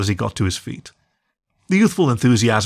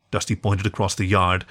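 ordinary delivery, nothing marked

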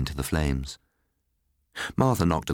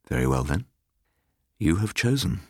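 cold. A narrator's reading. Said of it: "he said coldly".

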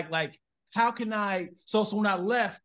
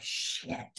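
breathy whispered swear under the breath; whispered curse; 10 dB quieter than usual. Retold or imitated. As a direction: whisper it to yourself. Quiet curse after awkward moment.

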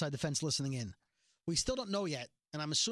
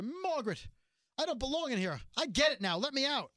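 begging; 6 semitones higher than usual. Retold or imitated. Gregory begging Maggie from the pen, high pleading whine. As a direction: plead to be let out like a coward. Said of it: "Gregory begs her".

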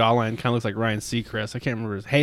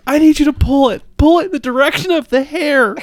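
much higher, louder frantic plea; hammed-up desperate instructions; mock frantic begging, pitched way up; pleading Bert; 13 semitones higher, 5 dB louder than usual. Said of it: "And he's like".

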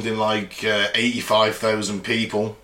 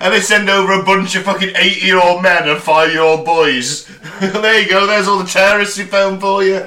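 loud, higher-pitched mock handover; hammed-up sarcasm breaking into a laugh; 7 semitones higher, 6 dB louder than usual.

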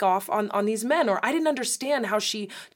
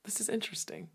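soft whispered aside; whispering; 12 dB quieter, faster than usual. Real, not acted. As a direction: breathe it to yourself. Aside while thinking.

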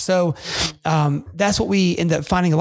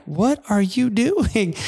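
imitating wife; 5 semitones higher, much slower than usual. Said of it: "kinda give me the eyes".

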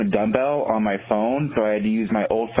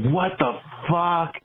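higher, drawn-out mock "what the fuck"; retold startled outburst; 8 semitones higher, slower than usual. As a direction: imitate a roommate's stunned reaction, not shouted. Retold roommate woken by blaring porn.

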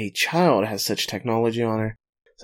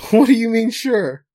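high, loud laughing disbelief; what do you mean, sure; incredulous; 11 semitones higher, 8 dB louder than usual. Real, not acted. Reacts to curt "sure.

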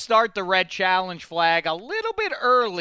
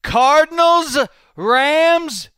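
announcer read; 4 semitones higher, 6 dB louder, much slower than usual. Acted. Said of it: "Here we go".

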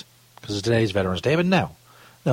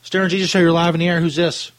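bright, raised radio-host call-in voice; 6 semitones higher than usual. Acted. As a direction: greet the caller like a DJ. Host takes a call on air.

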